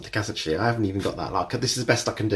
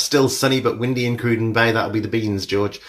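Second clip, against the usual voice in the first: ordinary delivery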